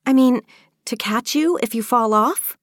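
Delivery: nervous, rising to a worried question; worried girl checking it's safe; tremulous. Acted. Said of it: "asked in a tremulous voice".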